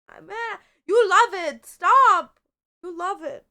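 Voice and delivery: high, loud teasing squeal; playful teasing. Real, not acted. Teasing the squirming cat, high and loud.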